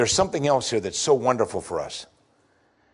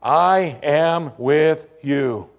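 very slow, weighty promise; solemn, slow; much slower than usual. Acted. Preacher quotes Jesus's promise to the congregation, slow and solemn. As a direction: let each word land.

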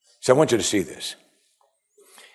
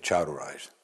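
quiet, easy, unforced; simple soft command; 10 semitones lower, 10 dB quieter than usual. Shown as a demonstration. Jesus raises the girl without any fanfare, softly. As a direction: say it almost offhand, softly. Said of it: "There wasn't any big moves".

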